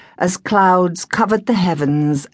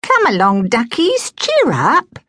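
put-on cheery Cockney girl; 8 semitones higher than usual. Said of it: "said Penelope".

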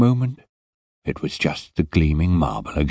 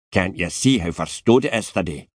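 put-on heavy Scottish accent, impatient and put out; a surly Scottish security man scolding; Scottish guard telling a boy to get up; grumpy Scottish guard; 4 semitones higher than usual. Scottish guard voiced in story.

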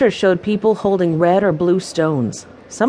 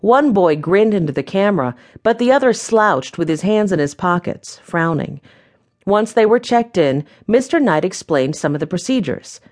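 ordinary delivery, nothing marked

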